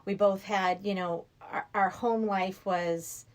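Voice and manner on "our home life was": usual speaking voice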